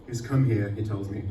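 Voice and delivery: ordinary delivery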